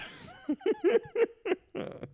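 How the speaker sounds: high bursting laugh; big laugh. Real, not acted. Laughing after retelling threat.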